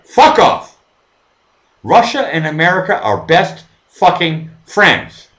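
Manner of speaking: loud, high accusation; angry rant. Real, not acted. Streamer scolding nationalistic viewers, angry loud accusing. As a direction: hurl the charge at them.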